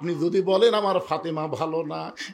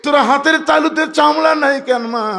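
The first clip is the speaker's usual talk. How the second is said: high, loud tearful lament; imitated tearful cry; 9 semitones higher, 3 dB louder than usual. Prophet asking Fatema about her blistered hands, tearful cry. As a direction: cry out to a daughter, pitched high. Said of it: "নবী কান্দার বলে".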